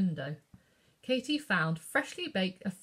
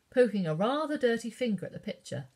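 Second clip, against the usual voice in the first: usual speaking voice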